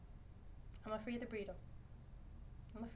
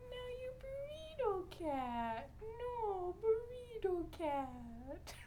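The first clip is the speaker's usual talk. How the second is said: cooing pet tease; 6 semitones higher, 6 dB quieter, slower than usual. Real, not acted. Owner gloating that the cat got attention, cooing tease. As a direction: gloat at a pet in slow, swooping coos.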